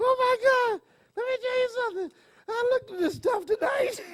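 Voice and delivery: high-pitched laughing talk. Real, not acted. Still laughing at her pills.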